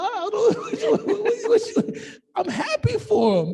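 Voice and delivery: high, laughing disbelief; laughing off the private jet bragging. Real, not acted. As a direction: laugh through the words, high and loud. Laughing through mock congratulations.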